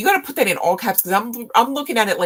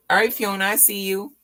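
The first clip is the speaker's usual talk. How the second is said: usual speaking voice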